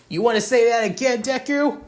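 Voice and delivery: acted bully's heated challenge; angry challenge. A narrator's reading. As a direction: dare him to repeat it. Bully threatens boy in story.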